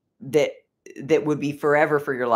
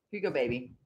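soft to the dog; 3 semitones lower, 10 dB quieter, faster than usual. Real, not acted. Soothing the dog.

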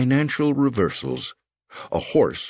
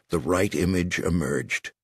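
plain reading voice

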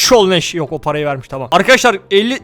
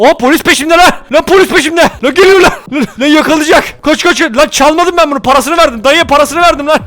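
higher and louder, breathless protest; panicked fleeing; 5 semitones higher, 3 dB louder than usual. Real, not acted. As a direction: shout in panic while running from police. Fleeing police in the game. (polisten kaçarken panikle bağırarak)

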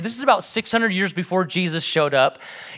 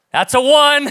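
put-on loud, higher dad counting the kids; stern parent call; 8 semitones higher, 8 dB louder than usual. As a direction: call it out like a father starting the count. Dad calling kids to obey.

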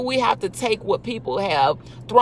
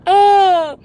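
a high, loud burst of laughter; laughing; 10 semitones higher, 6 dB louder than usual. Real, not acted. Laughing out loud at her own point. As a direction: crack up loudly.

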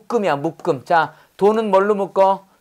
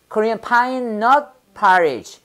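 slow model reading; much slower than usual. Shown as a demonstration. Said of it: "잣죽이 뭐랬냐?".

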